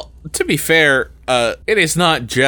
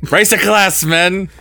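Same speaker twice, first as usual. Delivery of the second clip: high, raised rallying cry; rousing call; 7 semitones higher, 3 dB louder than usual. Acted. Mock officer rallying the men, high rousing call. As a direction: call the troops to a toast. Performs a rousing war line.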